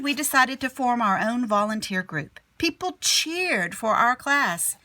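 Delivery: ordinary delivery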